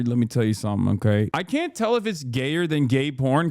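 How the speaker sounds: ordinary talk, nothing marked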